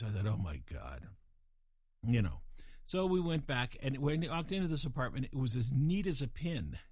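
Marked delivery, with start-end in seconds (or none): 0.3-1.1 low, muted groan of disbelief; unimpressed, dropped well down in pitch; eye-rolling exasperation. Retold or imitated. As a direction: say it under a weary eye roll. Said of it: "I rolled my eyes".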